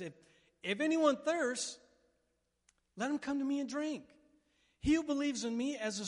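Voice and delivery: high, loud proclaiming shout. Retold or imitated. Jesus crying out at the festival, loud high shout. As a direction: cry it out over the trumpets. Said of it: "as loud as I think Jesus screamed".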